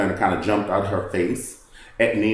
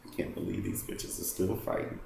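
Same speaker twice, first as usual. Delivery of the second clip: hushed, breathless whisper; 12 dB quieter than usual. Retold or imitated. Marlo's smug inner thought about the fighting, whispered.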